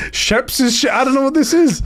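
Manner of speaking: high, baffled whine; mock confused voice. Imitating a confused man reading the Discord. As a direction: sound lost and fed up.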